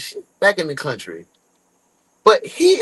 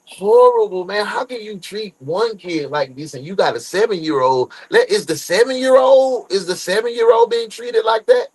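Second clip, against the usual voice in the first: ordinary delivery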